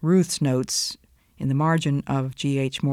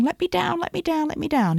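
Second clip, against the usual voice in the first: much higher, frantic little voice; squeaky chipmunk plea; 13 semitones higher than usual. Retold or imitated. Preacher voicing us pleading with the Lord, squeaky chipmunk cry. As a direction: beg like the tiny chipmunk again. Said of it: "And we're saying".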